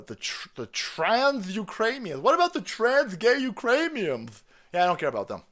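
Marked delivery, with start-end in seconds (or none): 0.0-4.4 high-pitched, drawn-out fake concern; mock distraught activist. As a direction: plead in hammed-up dismay. Mocks hysterical activists on Ukraine.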